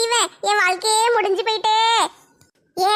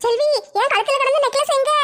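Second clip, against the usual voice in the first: alarmed; 5 semitones higher than usual. Acted. Discovers necklace missing.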